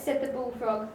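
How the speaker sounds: plain reading voice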